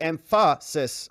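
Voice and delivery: emphasis said as em-FAH-sis; misplaced stress. Shown as a demonstration. Jokey misplaced stress continues.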